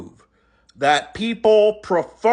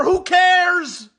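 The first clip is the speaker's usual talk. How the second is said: ordinary talk, nothing marked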